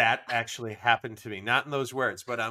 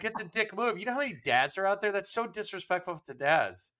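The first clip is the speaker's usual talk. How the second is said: mock offended stranger; 6 semitones higher than usual. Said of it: "And he's like".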